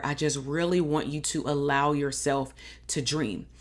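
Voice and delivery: ordinary talk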